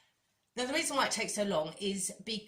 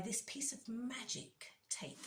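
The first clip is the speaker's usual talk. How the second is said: whisper; 4 semitones higher, 7 dB quieter than usual. Pretend. Whispering the magic tape reveal.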